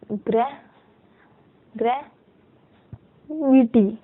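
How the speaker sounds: Gravity slowly in parts, with long gaps; sounding out. Shown as a demonstration. Teacher writes gravity in shorthand for students, sounding it out slowly. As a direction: break gravity into grey, वि, टी as if writing along. Sounding out word while writing it.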